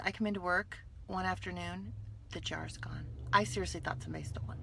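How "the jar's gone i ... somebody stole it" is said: hushed whisper. Retold or imitated. Hushed retelling of the theft.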